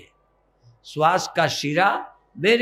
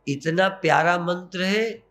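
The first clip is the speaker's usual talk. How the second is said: usual speaking voice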